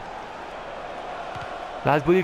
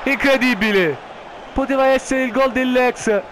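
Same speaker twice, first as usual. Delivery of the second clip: excited goal chance call; 8 semitones higher than usual. Acted. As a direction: shout the chance, then ease down.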